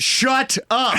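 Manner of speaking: comic sudden snap, drawn-out words; mock husband's outburst. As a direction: cut the wife off, hammed-up. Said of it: "it's like he screams at that guy".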